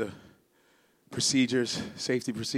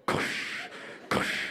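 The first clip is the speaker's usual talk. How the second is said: whispered cush; 4 dB quieter than usual. Retold or imitated. Describing a padded seatbelt.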